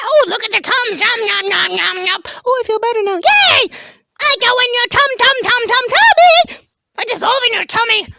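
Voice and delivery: very high, animated cartoon voice; excitable Tums character. Tums explaining itself to the carpet, high excitable voice. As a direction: play a peppy little talking tablet.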